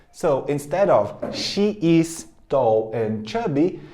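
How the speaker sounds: she is tall and chubby, slow with she and is apart; teacher contrasting the long form she is; full form, slow. Shown as a demonstration. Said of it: "eu tô dizendo she's".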